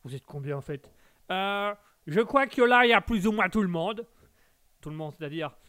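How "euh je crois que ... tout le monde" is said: voce del pubblico. Acted. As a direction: answer as one of the crowd. Plays the audience answering him.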